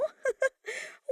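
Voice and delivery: high mocking cackle; cackling laugh. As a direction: burst into a taunting laugh. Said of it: "had the nerve to laugh".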